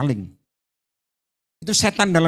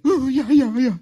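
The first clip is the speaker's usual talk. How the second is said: high eager yes-yes; high mock agreement; 8 semitones higher, slower than usual. Congregant conceding to the rebuke, high mock agreement.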